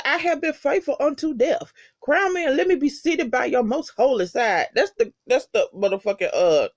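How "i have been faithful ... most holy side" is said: high-pitched devout proclamation, mimicked; praying cockroach. Reviewer voices the cockroach praising the Most High, high-pitched devotion. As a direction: recite it as a high, pious plea. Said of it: "giving praise to the Most High".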